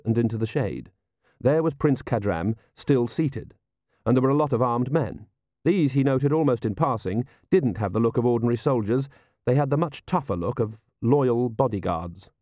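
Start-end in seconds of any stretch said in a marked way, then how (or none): none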